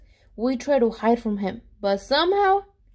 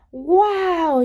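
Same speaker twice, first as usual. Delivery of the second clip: higher, louder amazed wow; impressed wow; 6 semitones higher, 5 dB louder than usual. Acted. Friend Jeremy seeing the big pie, amazed. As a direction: marvel at the pie. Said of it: "Jeremy said".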